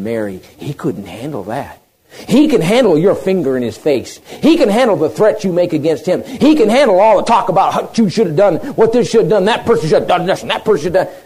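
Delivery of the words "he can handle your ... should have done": loud, raised-pitch preacher's list; forceful preaching. Real, not acted. Preacher listing what God can handle, forceful preaching. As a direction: pile up each charge with rising force.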